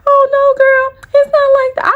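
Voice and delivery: very high, sugary put-on girly voice; mocking soft girl. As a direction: mimic her playing innocent, way up high. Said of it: "She trying to be all soft like".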